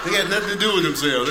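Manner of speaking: ordinary talk, nothing marked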